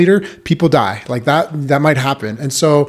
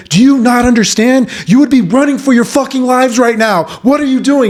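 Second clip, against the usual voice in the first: much higher, louder furious yell; yelling sergeant; 10 semitones higher, 4 dB louder than usual. Retold or imitated. First sergeant yelling at new privates, furious loud yell. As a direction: yell at them like their lives depend on it. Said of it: "he's, like, yelling at us".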